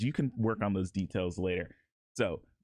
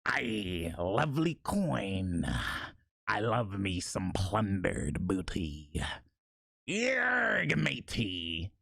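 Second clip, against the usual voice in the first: pirate voice; 3 semitones lower, much slower than usual. Shown as a demonstration. Sample pirate lines for viewers, in a slow pirate voice. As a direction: drawl the lines like an old sea dog. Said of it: "mix and match and put it together".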